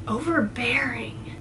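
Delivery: breathy puzzled whisper; whispered musing. Real, not acted. Musing over the word.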